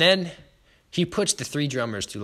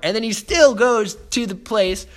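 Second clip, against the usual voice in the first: louder and higher, swept up in it; excited retelling; 6 semitones higher, 5 dB louder than usual. Real, not acted. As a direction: tell it breathless with excitement.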